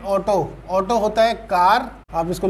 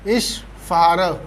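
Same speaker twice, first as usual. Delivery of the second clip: slow drill; 2 semitones lower, much slower than usual. Shown as a demonstration. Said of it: "हमारा rule क्या था".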